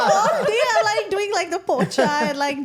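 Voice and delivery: put-on high, loud playful Indian accent; laughing through an Indian accent. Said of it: "When I'm really passionate about something".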